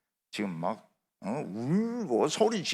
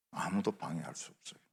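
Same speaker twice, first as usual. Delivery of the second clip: ordinary delivery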